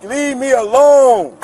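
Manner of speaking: high, slow, drawn-out holler; real exasperated outcry; angry demand. Real, not acted. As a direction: holler it slowly to make them back off. Telling police to leave him.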